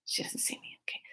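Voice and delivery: hushed whisper while hiding; whispered thought behind a corner. Retold or imitated. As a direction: breathe it so she can't hear. Hiding, peeking round the corner.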